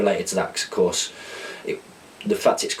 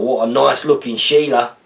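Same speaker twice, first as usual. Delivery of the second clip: put-on Australian accent; 4 semitones higher, 5 dB louder than usual. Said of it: "decipher these Australian slang terms slash words in an accent".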